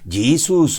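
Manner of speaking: "Jesus" said JEE-zus, in English; English pronunciation. Shown as a demonstration. Objector offers another correct name, English pronunciation. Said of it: "o debe ser".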